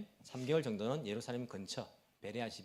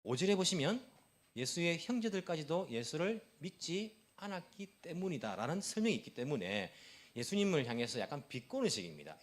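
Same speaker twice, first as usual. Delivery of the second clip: ordinary delivery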